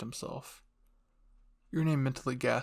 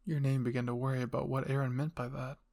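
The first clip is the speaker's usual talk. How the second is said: ordinary delivery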